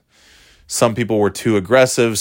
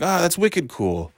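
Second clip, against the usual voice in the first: high, drawn-out imitation of a kid; New York kid; 8 semitones higher, 5 dB quieter than usual. Kids in New York using wicked, imitated slow and high. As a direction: stretch it out like an impressed playground kid. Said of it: "And they're like".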